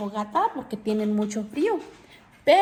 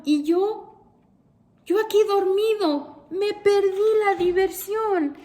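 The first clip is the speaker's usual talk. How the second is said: higher, whiny lament of a sad bear; 8 semitones higher than usual. A narrator's reading. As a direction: whimper it like a sulking bear. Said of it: "El oso está muy triste y solloza".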